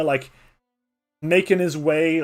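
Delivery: ordinary talk, nothing marked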